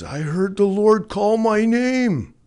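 high, loud ecstatic cry; exclaiming loudly. Retold or imitated. Retold testimony, head thrown back.

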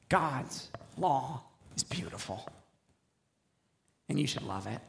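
hushed and soft like a shared secret; a soft secret told to a child. Acted. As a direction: lean in and confide it quietly. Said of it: "I wanna give you guys a secret".

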